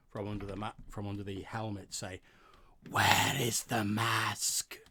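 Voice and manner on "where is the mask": slow, loud rasping reptile hiss; hissing reptilian voice. Acted. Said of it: "hissing, rasping reptilian voice".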